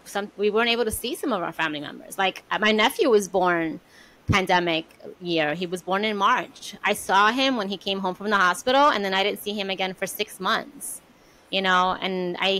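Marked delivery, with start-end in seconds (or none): none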